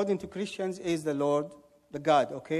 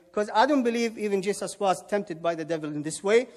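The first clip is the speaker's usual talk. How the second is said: usual speaking voice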